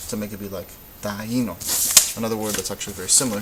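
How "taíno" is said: taíno with the í promoted, ta-EE-no; stressed weak vowel. Shown as a demonstration. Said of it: "the loudest letter of the".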